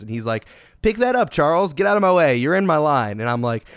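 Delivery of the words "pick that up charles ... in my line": louder, higher acted-out commands; Michael Jordan, impatient. Jordan shooing Barkley off his line, retold impatient orders. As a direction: imitate an impatient player clearing his putting line.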